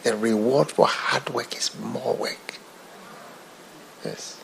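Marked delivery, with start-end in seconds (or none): 0.0-2.6 a hushed whisper of the preacher's words; whispered quote. Retold or imitated. Preacher quoting Reinhard Bonnke, whispering. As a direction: breathe it like a secret. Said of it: "he whispered it".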